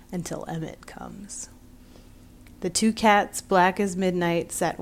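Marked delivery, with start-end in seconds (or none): 0.1-1.5 whispered, ominous cat voice; first cat answering in a whisper; eerie whisper. A narrator's reading. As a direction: breathe it like a secret threat. Second cat eerie reply.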